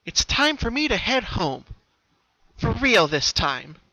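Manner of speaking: put-on high, lively young pony voice; Pinkie voice. Pinkie, resolved, speaking to herself at the door, high and lively.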